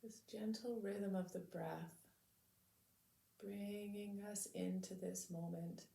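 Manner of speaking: calm, measured meditation voice with long pauses; unhurried, soothing lead-in to stillness; guided meditation. Real, not acted. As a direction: gently guide listeners to settle into the body.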